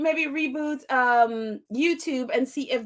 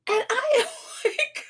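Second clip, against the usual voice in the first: higher, breaking into laughter; laughing in disbelief; 7 semitones higher than usual. Real, not acted. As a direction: laugh it off, overwhelmed. Overwhelmed retelling the racist scene.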